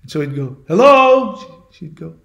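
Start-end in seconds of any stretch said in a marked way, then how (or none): 0.7-1.7 high, loud drawn-out hello; lost traveler's loud high call. Retold or imitated. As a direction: call into the distance for an answer. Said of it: "starts yelling out".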